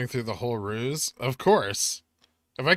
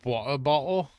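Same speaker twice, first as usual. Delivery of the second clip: put-on British accent; 3 semitones higher than usual. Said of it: "with a British accent".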